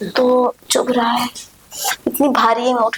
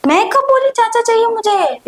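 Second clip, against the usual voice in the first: shocked denial; 9 semitones higher than usual. Acted. Denying friend's accusation.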